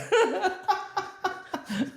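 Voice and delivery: real high-pitched burst of laughter; high laughing burst. Real, not acted. Laughing at her own story.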